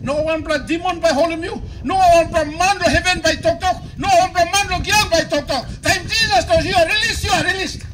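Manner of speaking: loud, driving preacher's cadence; full-force preaching. Acted. Pastor proclaiming release to the congregation, loud preaching. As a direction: declare release over them at full volume.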